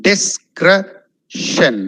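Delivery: Discretion very slow: dis... kre... shun; syllable breakdown. Shown as a demonstration. Teacher drilling Discretion, syllable by syllable. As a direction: split Discretion with gaps between syllables. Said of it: "it's discretion".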